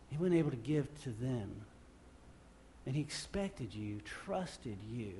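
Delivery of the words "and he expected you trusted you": softer, sincere appeal; soft, earnest. Real, not acted. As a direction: drop to a near hush.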